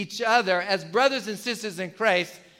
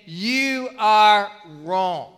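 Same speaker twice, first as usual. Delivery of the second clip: very slow, louder, each word weighed; stern rebuke; 6 dB louder, much slower than usual. Retold or imitated. Pastor voicing a rebuke to the congregation, slow and stern. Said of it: "It's, it's saying".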